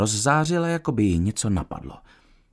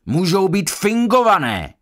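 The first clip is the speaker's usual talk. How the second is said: heated, raised-pitch shout; Parkins; 7 semitones higher, 3 dB louder than usual. A narrator's reading. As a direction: shout it back, higher and a little louder. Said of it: "zařval Parkins". (zařvání)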